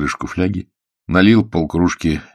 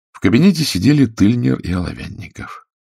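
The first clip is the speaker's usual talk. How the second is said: ordinary delivery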